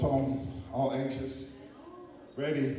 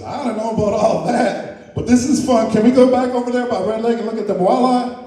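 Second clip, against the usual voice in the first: loud, eager and thrilled; excited; 4 semitones higher, 8 dB louder than usual. Acted. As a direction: gush with excitement. Eagle thrilled by flight.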